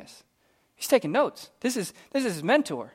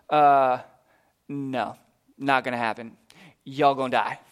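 laid-back Elijah; 6 semitones lower than usual. Acted. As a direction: shrug off the threat like it's nothing. Elijah voiced refusing the soldiers.

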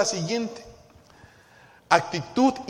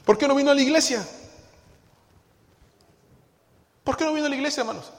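high, quick mock interrogation; pastor's challenge; 9 semitones higher, 4 dB louder, much faster than usual. Pastor asking a member why they missed church, acted challenge. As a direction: press the absentee with a pitched-up question.

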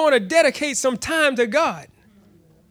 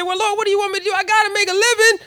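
pleading protest; 8 semitones higher, much faster than usual. Retold or imitated. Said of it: "And I said".

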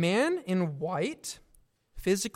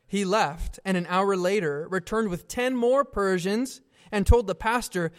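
normal talking voice

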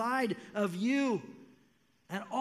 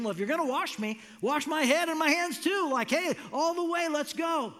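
higher and louder, all in; eager overkill; 7 semitones higher, 4 dB louder than usual. Retold or imitated. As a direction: Peter swinging to the other extreme, eager and loud. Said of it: "he goes to the opposite extreme".

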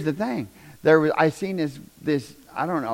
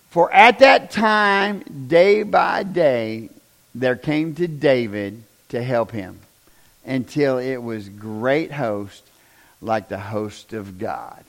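scripture reading; 4 semitones lower, 4 dB louder, slower than usual. Real, not acted.

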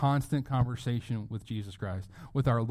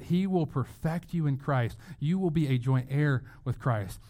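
usual speaking voice

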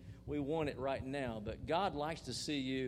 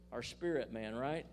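ordinary delivery

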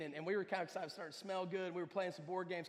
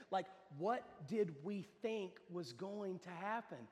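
slow, softer, measured question; sober reflection; 4 dB quieter, slower than usual. Real, not acted. Preacher asks people today, slow sober question. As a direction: spell it out slowly for them to ponder.

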